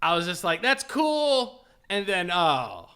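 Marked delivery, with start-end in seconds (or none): 0.6-1.6 high, impressed exclamation; retold admiration. Telling his reaction to the biker, impressed. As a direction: imitate being impressed, raised pitch.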